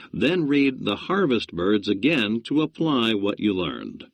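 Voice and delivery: normal talking voice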